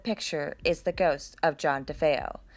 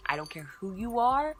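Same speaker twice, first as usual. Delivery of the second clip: ordinary talk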